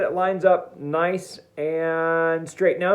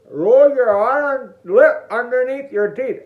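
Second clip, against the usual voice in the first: higher, louder, garbled by a tucked-in lip; slow, mouth-distorted demonstration speech; lip rolled in; 6 semitones higher, 7 dB louder, slower than usual. Shown as a demonstration. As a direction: talk with your lip over your teeth. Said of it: "Again, for those who can't understand".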